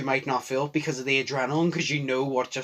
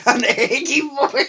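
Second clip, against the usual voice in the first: laughing high and slow; 12 semitones higher, 8 dB louder, slower than usual. Real, not acted. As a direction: laugh the words out, delighted. Laughing echo of friend's word.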